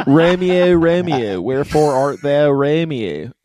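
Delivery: loud Philly-accented Shakespeare; exaggerated Philly accent. Shown as a demonstration. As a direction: declaim it loudly with Philly vowels. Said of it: "if I had a Philly accent".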